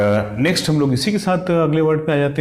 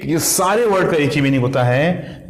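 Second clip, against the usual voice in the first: ordinary talk, nothing marked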